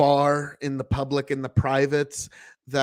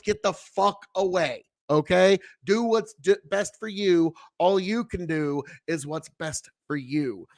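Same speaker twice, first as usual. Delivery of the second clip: ordinary talk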